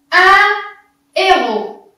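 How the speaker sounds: "Un héros" drawn out, loud, no h sound; slow demo. Shown as a demonstration. Teacher reading the example slowly to pupils. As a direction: stretch "Un héros" slowly and clearly. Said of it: "on ne prononce pas".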